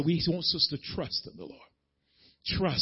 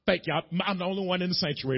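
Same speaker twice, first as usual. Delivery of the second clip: ordinary talk, nothing marked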